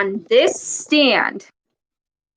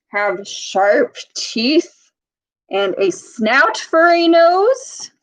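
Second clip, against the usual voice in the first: a mild monster build-up; spooky emphasis; 4 semitones higher, slower than usual. Acted. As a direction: draw out the teeth. Describing a monster librarian.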